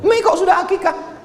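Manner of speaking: very high-pitched astonished question; squeaky, exaggerated incredulity; comic disbelief. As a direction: shoot up high in mock astonishment. Acted astonishment at early aqiqah.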